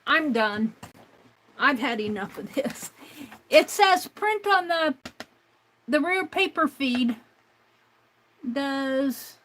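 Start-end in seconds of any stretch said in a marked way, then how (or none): 3.5-7.2 louder, higher, fed up reading it out; re-read it in disbelief, loud and fed up; exasperated. Real, not acted. Exasperated reread of message.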